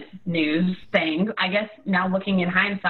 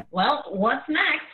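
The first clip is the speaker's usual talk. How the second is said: slow, higher-pitched "oh well"; half-joking, lifted and slow; mock resignation; 6 semitones higher, much slower than usual. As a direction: draw it out like a verbal shrug. Voicing her own resigned shrug.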